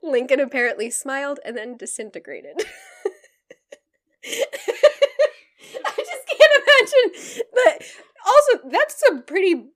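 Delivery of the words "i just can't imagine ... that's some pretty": laughing hard, pitched high. Real, not acted. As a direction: squeal through the laughter. Laughing through the comment.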